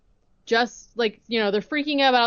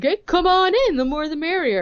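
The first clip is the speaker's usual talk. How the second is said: chirpy welcome; 9 semitones higher, 3 dB louder than usual. Retold or imitated. Sister's overly welcoming invite.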